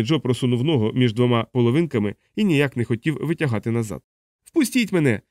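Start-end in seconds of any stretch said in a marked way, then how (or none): 4.4-5.2 desperate, much higher begging; hungry Joe begging through the door, much higher; tearful plea. A narrator's reading. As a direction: plead to be let in. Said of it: "сльозно благав він".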